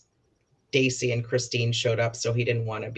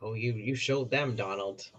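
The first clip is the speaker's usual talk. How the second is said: dry, quiet mock praise; sarcastic; 3 semitones lower, 8 dB quieter than usual. Real, not acted. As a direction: needle him softly, unimpressed. Sarcastic jab at Donald's clip.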